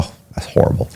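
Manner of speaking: hushed, mock-sorrowful "that's horrible"; fake sympathy whisper. Inmate fakes pity for re-indicted Ron, whispering. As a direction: pretend to care, barely above a breath.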